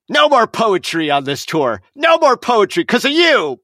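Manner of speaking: rising to an outraged yell; stage manager banning poetry in a fury; angry yell. Retold or imitated. Stage manager blames the poet.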